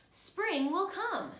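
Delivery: high, slow, sing-song kite voice; kites swishing. A narrator's reading. Kites calling over the night sky, high and hopeful. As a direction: let the kite call out its hope, lifted high. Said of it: "they swish".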